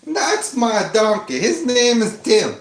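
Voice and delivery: slow proud hillbilly twang. Acted. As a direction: drawl it out, proud of the donkey. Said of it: "You like my hillbilly voice?".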